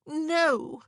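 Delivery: high, loud shocked cry. A narrator's reading. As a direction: cry out in dismay. Sister cries out in shock.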